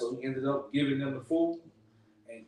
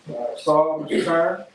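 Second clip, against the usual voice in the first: calling out names; 3 dB louder than usual. Real, not acted. Leader summons the men by name, calling out loud. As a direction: call each name across the room.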